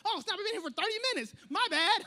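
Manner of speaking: high, rapid, mock alarm; startled. Phone scroller realising thirty minutes passed, startled. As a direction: blurt it out like you lost track of time. Acted surprise at time spent scrolling.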